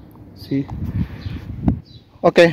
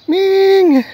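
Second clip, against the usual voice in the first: laughing call. Real, not acted. Calling the cat by name.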